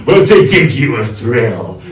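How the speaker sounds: Cockney accent, hammed-up for kids; a mild Cockney villain calling out to the kids; Cockney Grinch. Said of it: "one of the characters I like to do is the Cockney Grinch".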